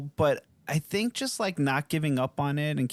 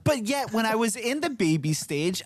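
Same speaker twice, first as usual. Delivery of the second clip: ordinary talk, nothing marked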